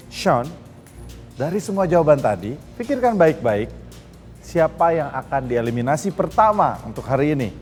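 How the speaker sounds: ordinary talk, nothing marked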